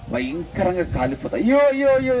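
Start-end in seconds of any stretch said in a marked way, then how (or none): 1.4-2.2 high, loud anguished wailing from hell; wailing cries. Acted. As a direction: cry out in torment, much higher and louder. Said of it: "అని ఏడుపు కేకలు". (నరకంలో ఆత్మ ఏడుపు కేకలు)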